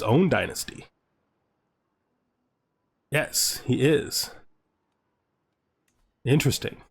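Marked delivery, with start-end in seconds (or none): none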